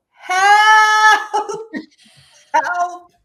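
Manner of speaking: high, loud cry for help breaking into a laugh. Retold or imitated. Woman retelling breaking down, high loud cry for help. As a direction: call out desperately, then laugh at it. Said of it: "I go".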